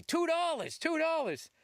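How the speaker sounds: a light high paperboy holler; paperboy call. Retold or imitated. Imitates eighties paper boy.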